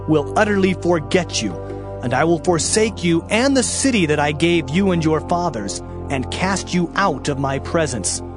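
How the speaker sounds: ordinary talk, nothing marked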